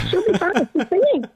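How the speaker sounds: sister's line lifted high with delight; amused sister. Retold or imitated. Recalling her sister shooing doctors out, high and amused. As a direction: sister tells the doctors she's fine, high and cheerful. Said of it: "That was the funniest thing".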